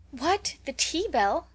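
high, slow, surprised outcry; drawn-out dismay at the interruption; dismayed cry. A narrator's reading. The girls protesting the tea bell, crying out high. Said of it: "cried everybody".

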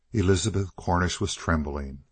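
plain reading voice

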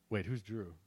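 ordinary delivery

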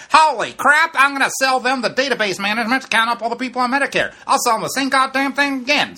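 high, loud gleeful scheming; excited scheming tycoon. Retold or imitated. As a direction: squeal it like a tycoon striking gold. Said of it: "he's like".

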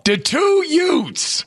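high, very slow teasing echo; mock youths. Teasing co-host's word choice, slow high echo. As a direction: drag it out teasingly.

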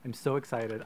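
ordinary talk

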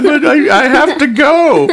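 squeaky pleading cat, high up and giggling; high laughing cat voice. Acted. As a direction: play the cat begging through laughter.

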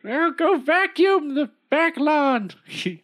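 put-on bossy old woman. Old woman orders husband.

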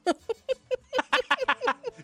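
high, loud laughing burst; burst of laughter. Real, not acted. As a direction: crack up suddenly. Laughs at Shining axe news.

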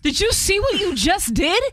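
high, disbelieving question; incredulous scolding. Retold or imitated. Telling her friend she missed her chance, high disbelieving scold. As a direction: hammer home what she missed. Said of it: "I go".

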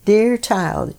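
normal talking voice

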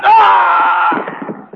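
sudden very high, very loud cry; savage attack shriek. Acted. Killer lunging at Stebbins, a sudden savage shriek. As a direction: lunge with a wild shriek. Killer strikes his victim.

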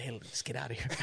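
mock hushed suggestion to leave; retold aside. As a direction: drop your voice as if slipping away. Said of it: "I'm like".

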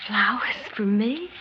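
awed whisper. Acted. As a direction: breathe it out in a hushed whisper, moved. Repeated in awe.

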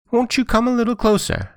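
very high, thin wheedling; high-pitched sly fox voice; fox feigning deafness. A narrator's reading. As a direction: lure them in with a falsetto plea. Fox lures Johnny Cake closer.

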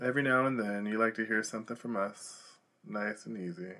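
soft, hushed stage intro; spoken song intro. Acted. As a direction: ease into the song, quiet and unhurried. Recites Proud Mary spoken intro.

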